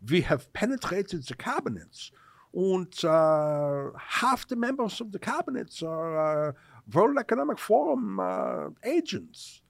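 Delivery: mock a German Bond villain; German accent.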